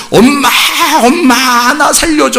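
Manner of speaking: high-pitched mock cry for rescue; baby screaming for help. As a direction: act out a baby calling desperately for its parents. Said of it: "소리 막 지르는 거예요". (살려달라고 소리치는 아기 흉내)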